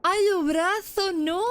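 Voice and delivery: high, drawn-out scream of fright; panicked shriek. Real, not acted. Player cornered by the monster, slow high shriek. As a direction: wail it slowly, terrified.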